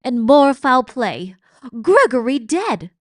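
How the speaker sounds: loud high shout. A narrator's reading. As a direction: cry it out in alarm. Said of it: "shouted Cook".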